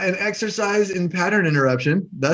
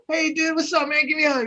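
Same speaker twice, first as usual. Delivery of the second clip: much higher, breezy bro call; mock-friendly greeting; 12 semitones higher than usual. To the next car thief, a high, breezy buddy greeting. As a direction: act delighted to see him. Second thief, hug offered.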